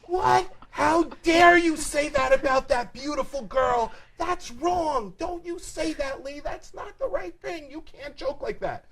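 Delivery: loud, high-pitched indignant aunt impression; hammed-up shocked telling-off; imitated outraged scolding. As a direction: scold at the top of a put-on high voice.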